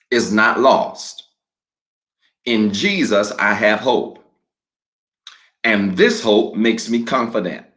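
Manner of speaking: slow, measured declaration with long pauses for the congregation to repeat; call-and-response declaration, slowed way down; slow declaration reading. Real, not acted. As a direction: read each phrase slowly and leave room for echoes. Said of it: "I'm gonna read it slowly".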